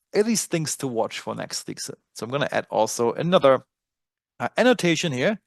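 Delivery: normal talking voice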